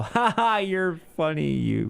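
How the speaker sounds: high put-on chuckle sliding into teasing; mock amusement. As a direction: pretend laugh, starting high. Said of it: "we'll go".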